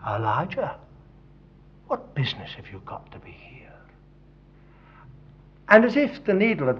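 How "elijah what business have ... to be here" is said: hushed whisper, unvoiced at the end; the Lord whispering to Elijah; whispered voice of God. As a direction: speak as the Lord, barely above a breath. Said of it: "And then the Lord said".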